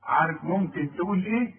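ordinary talk

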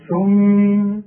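ثم stopped, mim held nasal two beats; pause ghunna. Shown as a demonstration. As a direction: stop on ثم, hum the mim. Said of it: "شوف الغنة دي".

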